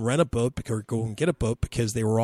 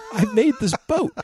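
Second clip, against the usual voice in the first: put-on higher, louder, laughing kid voice; kid's voice, laughing; 10 semitones higher, 6 dB louder than usual. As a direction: retell the boy through laughter. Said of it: "He goes".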